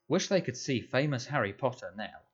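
ordinary delivery, nothing marked

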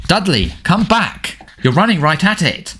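high, slow urgent calling out; Harry calling Dudley back, high and urgent. Acted. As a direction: call him back before it's too late. Harry calling Dudley away from danger.

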